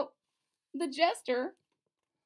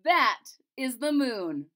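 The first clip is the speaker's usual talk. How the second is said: jester voice; 2 semitones higher, 9 dB louder, slower than usual. Acted.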